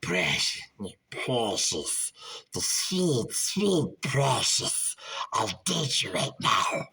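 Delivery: slow, breathy creepy whisper. Acted. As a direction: hiss it like a creepy creature whispering. Creepy whisper opening bit.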